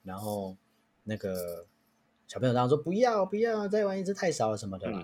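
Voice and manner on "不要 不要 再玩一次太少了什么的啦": put-on high whiny kid refusal; child begging for more turns; kid protesting. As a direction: whine it like a stubborn child. Child refuses mom, whining.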